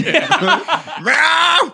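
loud, high cat yowl; cartoonish imitated cat cry; mock meow. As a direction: belt out a drawn-out meow. Joke naming baby after a sound.

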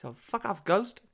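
high-pitched mock Cork voice; Cork accent. Podcasters swapping Cork catchphrases, high mock lilt. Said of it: "Oh, Cork".

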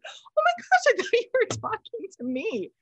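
very high-pitched, flustered relief; imitated startled reaction. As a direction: squeal it out, mimicking a flustered guest. Retold startled misunderstanding with the daughter.

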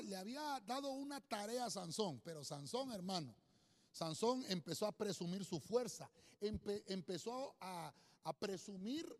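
ordinary talk

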